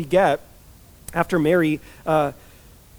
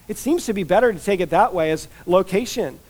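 ordinary delivery

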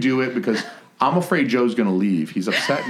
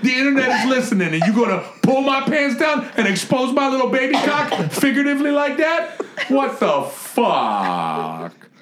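very high, strained comic indignation; hammed-up squealing protest at being humiliated; mock outraged rant; 13 semitones higher than usual. Host accuses co-host of turning his joke on him, mock outraged.

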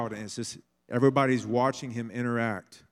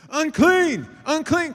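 high, loud warning call; crowd calling unclean; 12 dB louder, slower than usual. Retold or imitated. Crowd shouting a warning at the unclean woman, loud call. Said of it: "people are saying this".

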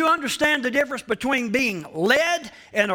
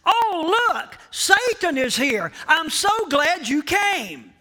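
mock party greeting; 5 semitones higher than usual. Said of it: "Never have you heard at a party".

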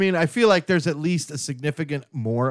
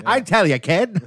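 vaudeville voice; 6 semitones higher, 4 dB louder than usual. Acted. Vaudeville banter continues.

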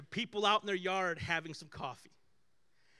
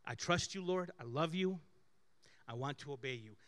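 soft prayer; 6 semitones lower, 6 dB quieter than usual. Retold or imitated. Prayer of a trusting soul.

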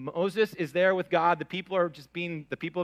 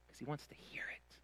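whisper; 18 dB quieter than usual. Real, not acted. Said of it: "whispered aside".

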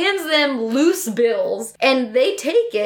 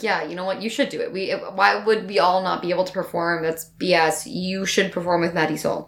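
mature Galleria; 7 semitones lower, 4 dB quieter than usual. Retold or imitated. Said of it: "Galleria was very mature and was like".

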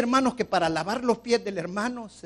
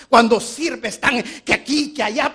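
loud; 6 semitones higher, 10 dB louder than usual. Acted. Repeated phrase rises to a cry.

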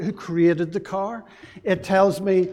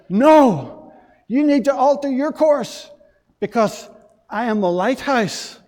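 louder and higher, triumphant private; loud punchline; 5 semitones higher, 5 dB louder than usual. Retold or imitated. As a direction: deliver the reveal with a flourish. Lighthouse keeper's triumphant punchline.

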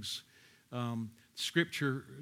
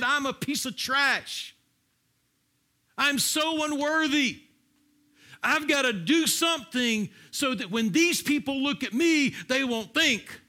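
anguished self-loathing; 11 semitones higher, 6 dB louder than usual. Acted. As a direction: cry out the worthlessness at full volume. Acting out Jephthah's inner self-loathing.

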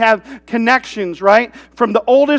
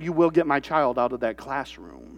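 low, soft, controlled demand; stern mother; 8 semitones lower, 12 dB quieter than usual. Retold or imitated. Mother confronting the school for her child, quietly stern. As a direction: quietly lay down the law.

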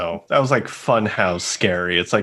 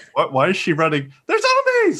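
sudden high alarmed cry; alarmed shock; 8 semitones higher than usual. Retold or imitated. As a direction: realise the danger. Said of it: "It's like".

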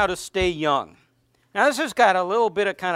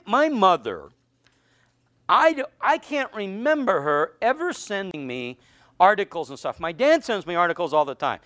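normal talking voice

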